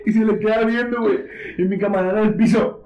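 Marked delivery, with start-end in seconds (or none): none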